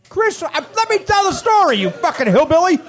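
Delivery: hammed-up anger at a fan; mock scolding. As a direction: tell the heckler off in jest. Mock-angry at heckler.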